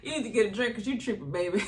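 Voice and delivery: fast, laughing sassy put-down; quick laughing mimic of a sassy accent; Stormy's accent. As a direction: tease "baby" the way Stormy said it, laughing. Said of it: "That accent killed me too".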